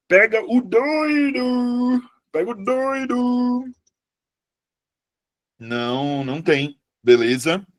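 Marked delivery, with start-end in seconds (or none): 0.1-2.0 "doido" drawn out high and slow; mock alarm call; hammed-up call. Shown as a demonstration. 2.3-3.8 high, slow, drawn-out "pega o doido"; hammed-up cry to catch the madman; mock cry of alarm. Teacher jokes about his own slip.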